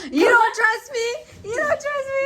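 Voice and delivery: high squeaky laughing disbelief. Real, not acted. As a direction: squeal it in mock hurt. Mock offense, laughing.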